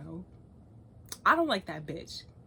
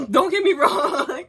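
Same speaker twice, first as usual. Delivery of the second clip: slow, louder, rising line through laughter; laughing; 7 semitones higher, 11 dB louder, slower than usual. Real, not acted. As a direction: laugh as you backpedal. Laughing reassurance.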